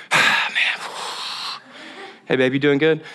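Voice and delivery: heavy sighs between words; sighing, forcing small talk with wife; sighing heavily. Retold or imitated. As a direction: breathe out your stress, barely hiding it. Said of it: "my breathing is so obvious".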